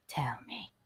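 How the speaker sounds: soft breathy whisper. Acted. Host asking viewers about her audio, whispering. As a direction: coax the answer out in a whisper.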